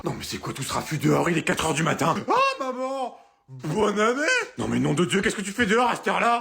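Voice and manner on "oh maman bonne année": put-on drunken cheer. Drunk son cheerfully greeting his mother at the door.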